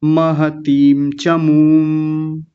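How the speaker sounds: Acharya महतीम very slow, syllable by syllable; drawn-out model. Shown as a demonstration. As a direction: stretch out महतीम for the class. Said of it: "हलंत का मतलब वहीं रुक जाना".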